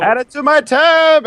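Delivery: high-pitched playful shout; calling out on the run. Pretend. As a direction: toss it out loudly as you flee. Calling out while running away.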